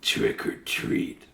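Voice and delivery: whispered, slow trick or treat; slow soft whisper; very softly. Acted. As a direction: breathe it out timidly. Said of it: "so he said very softly".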